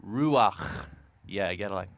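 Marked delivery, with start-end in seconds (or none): 0.0-0.9 Ruach finished with a scraped guttural kh deep in the throat; Ruach, the final ch rasped like a tickle in the throat; phlegmy throat sound. Shown as a demonstration. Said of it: "get a little phlegmy in there".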